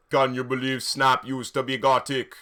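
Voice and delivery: mock Jamaican character asking in disbelief; Jamaican accent. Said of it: "he had a Jamaican accent".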